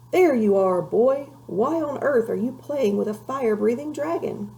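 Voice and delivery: a stern father shouting across the yard; calling out. A narrator's reading. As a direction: call the boy over sharply. Father calls out at boy.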